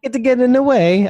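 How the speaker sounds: high-pitched, hammed-up Italian accent; playful fake Italian voice; mock Italian accent. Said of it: "I'm talking Italian".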